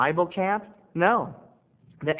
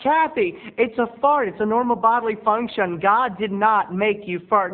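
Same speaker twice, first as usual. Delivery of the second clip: higher, louder pleading with Kathy; teen Terry protesting to Kathy, high and loud; exasperated protest; 6 semitones higher, 4 dB louder than usual. Retold or imitated. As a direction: talk her out of it, worked up. Arguing with Kathy at camp.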